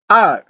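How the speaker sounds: R starting high and falling sharply; short falling Mandarin tone on R; fourth tone. Shown as a demonstration. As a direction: drop R steeply from a high start. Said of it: "fourth tone".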